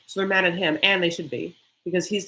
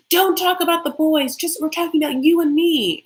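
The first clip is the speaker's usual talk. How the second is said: louder, much higher retold protest; exasperated mock outburst; 9 semitones higher, 5 dB louder than usual. She cuts him off about the boys, mock exasperated. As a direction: raise pitch and volume, imitating an annoyed girlfriend. Retold quarrel, quoting Anna.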